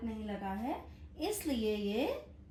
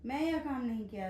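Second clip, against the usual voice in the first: hurried. Retold or imitated. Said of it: "बोल देते हैं जल्दी में".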